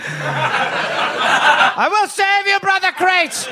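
high, grand heroic proclamation; rally the crates like a mock hero; heroic savior voice. Voicing Reg as crate hero.

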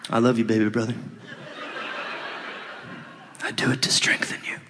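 quiet, whispered and tender; soft tender hush. Acted. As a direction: whisper it lovingly. Tender brother.

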